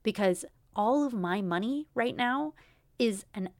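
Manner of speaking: ordinary talk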